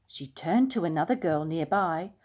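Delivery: plain reading voice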